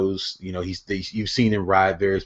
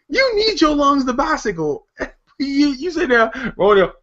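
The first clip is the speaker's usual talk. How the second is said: laughing; 3 dB louder than usual. Real, not acted. Laughing rant about smoking cyclists.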